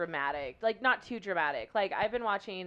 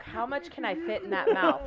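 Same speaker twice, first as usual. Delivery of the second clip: imitating friends; 9 semitones higher, 4 dB louder than usual. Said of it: "you're like".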